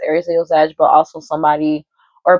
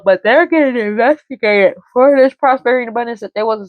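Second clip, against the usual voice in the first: real yawn pushing the voice higher; yawning while talking; 6 semitones higher than usual. Real, not acted. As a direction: keep talking through an unexpected yawn. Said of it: "I don't know why I'm yawning".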